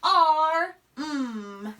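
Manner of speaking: ar-m split into two parts, ar then m; segmented arm. Shown as a demonstration. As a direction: stretch ar-m apart into its sounds. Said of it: "It's just".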